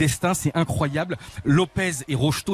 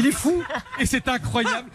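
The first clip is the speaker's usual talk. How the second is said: laughing; 7 semitones higher than usual. Real, not acted. Laughing at an amazing story.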